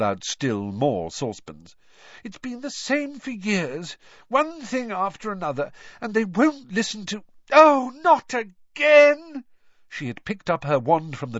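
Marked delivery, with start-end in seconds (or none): 2.2-7.2 frustrated mother's high-voiced grievance; exasperated rant. A narrator's reading. Exasperated mother ranting about her sons. 7.5-9.4 high, very loud, drawn-out cry; slow, loud outburst of frustration; exasperated shout. A narrator's reading. Mother finds another fake wand.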